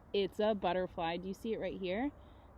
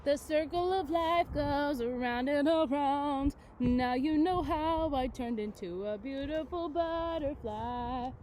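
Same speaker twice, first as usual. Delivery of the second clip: slow, louder, sing-song closing lines; storybook ending; 5 semitones higher, 6 dB louder, slower than usual. Acted. Teacher reads the book's last page, slow and loud. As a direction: draw out the grand finale of the story. Reads book aloud to class.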